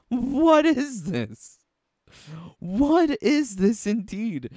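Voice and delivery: high, drawn-out disbelief; baffled outcry. Real, not acted. Reviewer appalled by chain mail leggings with open-toed heels, baffled outcry. Baffled outburst at the costume design.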